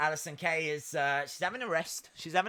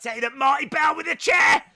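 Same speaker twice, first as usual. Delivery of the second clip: excited shout; 7 semitones higher, 10 dB louder, faster than usual. Real, not acted. Live commentary, excited shouting.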